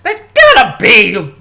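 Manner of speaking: very loud, high, slow mock bellow; hammed-up Crowder-style roar; Crowder version. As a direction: bellow it as Crowder would, breaking up. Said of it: "the Crowder version".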